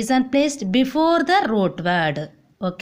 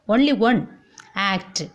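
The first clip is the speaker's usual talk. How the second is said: ordinary delivery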